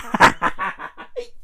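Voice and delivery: real wheezing burst of laughter; breathy laugh. Real, not acted. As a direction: crack up helplessly, running out of breath. Cracks up at his own retelling.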